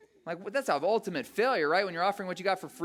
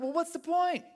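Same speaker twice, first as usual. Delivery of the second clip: fed-up protest; 12 semitones higher, 4 dB louder than usual. Acted. Voicing someone fed up with God.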